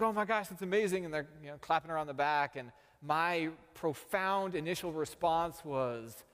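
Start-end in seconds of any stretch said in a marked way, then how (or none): 0.0-1.1 excited retelling. Retold or imitated. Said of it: "the two guys are like".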